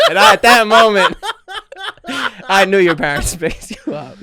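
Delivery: laughing hard mid-sentence; high, loud laughing triumph. Real, not acted. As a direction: let the laugh break through the words. Laughing while telling.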